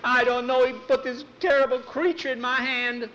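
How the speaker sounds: high frightened cry; panicked. Acted. Nancy tells Tom in panicked fright. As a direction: wail it out in fright.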